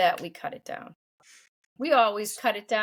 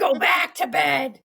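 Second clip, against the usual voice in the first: slow, loud, higher mock yell; drawn-out playful shout; mock scolding shout; 4 semitones higher, 7 dB louder, slower than usual. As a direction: holler it like you're packing someone off. Acting out a heckler's scolding.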